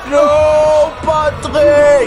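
high, loud dismayed cry; shouting. Real, not acted. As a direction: cry out as a friend is caught. Character caught by monster.

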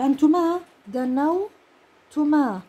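أنتما دنوتما stretched out at half speed; a teacher dictating to students; slow dictation. Shown as a demonstration. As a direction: spell out دنوتما slowly. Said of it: "بنحط الفتحة".

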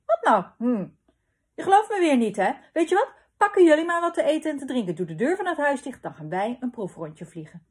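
high, loud protest rushing into orders; defensive, then brisk. A narrator's reading. Papa to doubting mama, loud defensive bluster. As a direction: object loudly, then rattle off a decision.

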